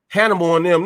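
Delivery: usual speaking voice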